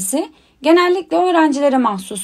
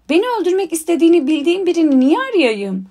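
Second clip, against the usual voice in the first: quick, higher-pitched astonished question; bewildered; 5 semitones higher, faster than usual. A narrator's reading. Boy asks why he would seek out his would-be killer, bewildered. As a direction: ask it fast, baffled by the idea. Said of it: "Harry şaşkınlıkla".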